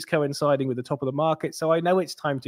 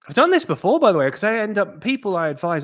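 excited aside; 7 semitones higher, 4 dB louder than usual. Real, not acted. Excited aside about advising.

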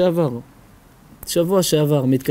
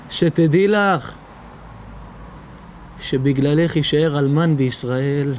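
grave warning; slower than usual. Acted.

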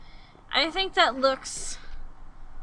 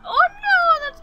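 sudden high, loud cry of dismay; alarmed yelp; 10 dB louder than usual. Real, not acted. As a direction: yelp it as something goes wrong. Alarmed at a building mistake.

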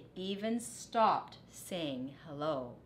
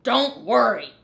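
much louder shout; dog shouting back to Rat; 4 semitones higher, 12 dB louder than usual. A narrator's reading. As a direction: yell it back. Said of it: "Dog shouted".

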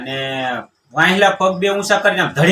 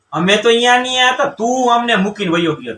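raised, indignant scolding that sinks back down; exasperated retort; 6 semitones higher than usual. Retold or imitated. Uncle scolding the crying groom Paulo, raised indignant retort. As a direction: tell the sobbing fool off, pitched up.

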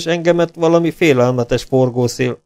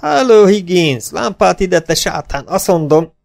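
slow, high, loud rousing call; excited calling; 6 semitones higher, 3 dB louder than usual. Acted. Townsman rousing sleepers, excited and loud. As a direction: wake the house up.

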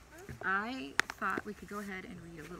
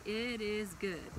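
put-on slow, deliberate model phrase for a child; slow teacher demo; slow model line; much slower than usual. Shown as a demonstration. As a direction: say it slowly so they can copy. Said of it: "You can say".

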